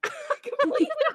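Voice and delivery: high, bursting laugh running into the words. Real, not acted. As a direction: laugh it out in disbelief. Laughing at her own story.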